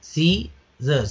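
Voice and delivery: सीजर्स very slowly, "SEE... zurs"; stretched सीजर्स for learners; syllable-by-syllable demonstration. Shown as a demonstration. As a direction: break सीजर्स into two parts. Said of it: "उसको घींच कर बोलते हैं लोग".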